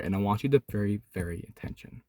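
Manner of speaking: low, soft and serious; serious hush. Real, not acted. Calling young viewers to listen, low and soft. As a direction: drop your voice to draw them in. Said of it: "the serious part".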